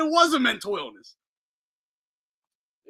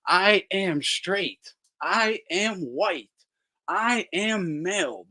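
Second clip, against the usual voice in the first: mock solemn declaration; 4 semitones lower, much slower than usual.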